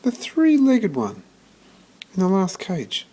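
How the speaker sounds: put-on high, firm boy's voice; a small boy standing his ground; boy confirming to the lady, high and sure; young George. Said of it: "George nodded".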